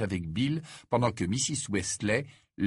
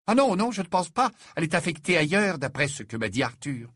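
higher, louder motherly voice explaining arrangements; high, loud bustling mother; brisk Mrs Weasley; 7 semitones higher, 3 dB louder than usual. Acted. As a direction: play the busy mother laying out the plan.

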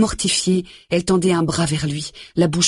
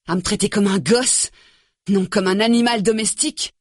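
high, cutting angry rant; bitter tirade; 7 semitones higher than usual. A narrator's reading. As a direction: spit the insults out. Nick insulting Grace.

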